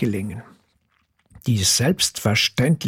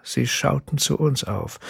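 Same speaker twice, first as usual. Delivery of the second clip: ordinary delivery, nothing marked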